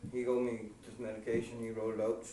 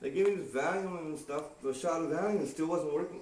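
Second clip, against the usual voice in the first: ordinary talk, nothing marked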